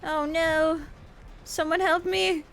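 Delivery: terrified horror victim. Real, not acted. Downed, calling for help.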